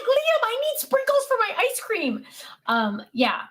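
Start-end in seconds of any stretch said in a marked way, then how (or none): 0.0-2.2 high, whiny plea for sprinkles; grandma pleading with grandson in pretend play; mock pleading. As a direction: whine like a disappointed kid.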